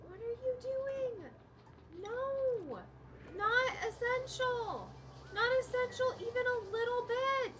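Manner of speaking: high, squeaky mock character voice; mock whiny voice. Gamer mocking characters in a squeaky high voice. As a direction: squeak it like a clueless little character.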